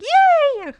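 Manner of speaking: high, loud mock cheer; excited cheer. Imitating him breaking out of monotone, excited cheer. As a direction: squeal it as a sudden burst of excitement. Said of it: "excited about something".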